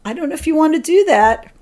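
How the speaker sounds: mock-wary nudge, brisk and high; intuition's gentle caution. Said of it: "Mine always sound like".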